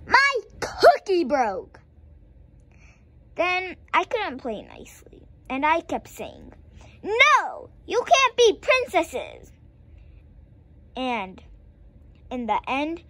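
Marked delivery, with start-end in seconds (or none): 0.1-1.8 upset. Acted. Child's dismay in story. 7.0-9.5 bossy. Acted. Bossy child refusing friend.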